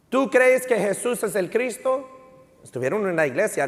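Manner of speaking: ordinary talk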